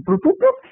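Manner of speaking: high rising electronic boops; imitated game beeps. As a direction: mimic arcade game bleeps.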